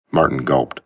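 usual speaking voice

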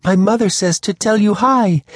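plain reading voice

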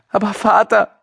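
put-on high, pitiful girl's whine; wailing. A narrator's reading. Daughter protesting to her father, high pitiful wail. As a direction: plead in a very high, distressed voice. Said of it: "jammerte die siebzehnjährige".

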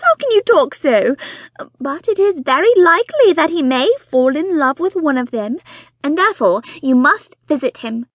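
high, loud, foot-stamping reproach; exasperated wife. Acted. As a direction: scold him like a frustrated wife. Mrs Bonnet scolding husband.

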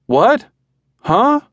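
very high, baffled what and huh; confused. A narrator's reading. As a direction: thrown by the question. Confused husband on the phone.

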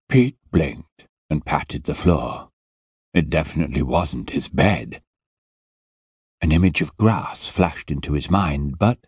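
usual speaking voice

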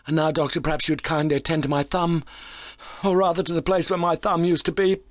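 high, animated character voice; keyed-up injured visitor. A narrator's reading.

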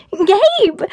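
high, sharp scream of a name; screamed call. A narrator's reading. Narrator's Fiona screams to Gabe as he surfaces, screamed call. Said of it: "I screamed".

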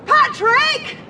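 high, urgent call across the park. Acted. Wife calls her husband by the river, a high urgent call. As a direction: shout his name to reach him far off.

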